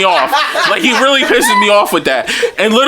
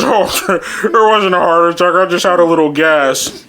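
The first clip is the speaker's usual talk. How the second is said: mumbled mock-dad explanation; lightly put-on garbled dad voice; imitating dad; 3 semitones lower than usual. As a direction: play dad sheepishly slurring it. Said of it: "And he says".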